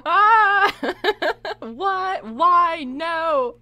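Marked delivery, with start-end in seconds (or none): 0.1-0.7 high, loud laughing shriek. Real, not acted. Streamer shrieking with laughter at demon bears.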